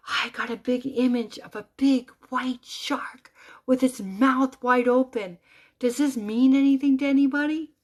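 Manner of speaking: loud, high, urgent retelling; medium screaming. Retold or imitated. Medium asking the audience about her vision, imitated screaming. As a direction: call it out to the room, rising in volume. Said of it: "this woman's screaming that she sees".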